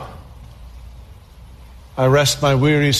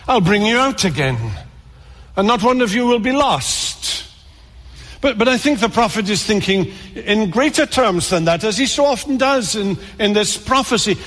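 fervent preacher reaching for glory; rising fervor; 5 semitones higher than usual. Real, not acted. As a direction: voice climbs high with growing passion. Preaching builds to high pitch.

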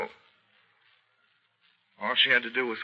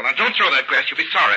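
high, loud alarmed warning; panicked cry to drop the glass; alarmed shout; 6 semitones higher, 12 dB louder than usual. Acted. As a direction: yell to stop him throwing it. Stopping him throwing a glass.

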